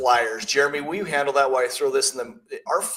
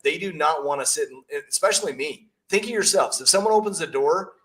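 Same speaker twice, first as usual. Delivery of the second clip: ordinary talk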